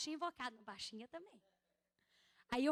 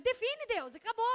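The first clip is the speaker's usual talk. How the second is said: higher and louder, fed up; exasperated outcry; 5 semitones higher, 8 dB louder than usual. Retold or imitated. Pastor crying out to God that she can't take more, loud and exasperated. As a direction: throw the words at God, at the end of her rope.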